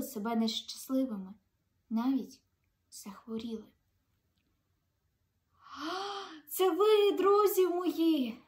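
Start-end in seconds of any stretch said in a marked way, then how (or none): 5.6-8.4 warm greeting. Acted. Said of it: "привітав найголовніший чарівник".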